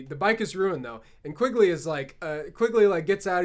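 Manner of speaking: ordinary talk, nothing marked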